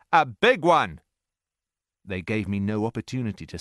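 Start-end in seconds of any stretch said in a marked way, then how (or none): 0.1-1.0 louder, higher triumphant shout; soldiers crying out. A narrator's reading. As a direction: call out as if you've caught another rebel. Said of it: "they cried".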